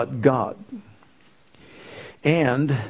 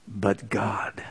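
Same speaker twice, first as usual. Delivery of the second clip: 1 whisper; 6 semitones lower, 7 dB quieter than usual. Acted. Answering his own question hushed.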